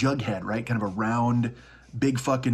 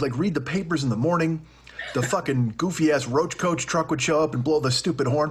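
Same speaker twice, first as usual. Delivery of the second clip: ordinary delivery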